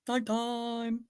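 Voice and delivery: Nighttime! said softly, barely voiced; hushed. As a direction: drop to a soft hush on Nighttime!. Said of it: "it's the nighttime, daytime one".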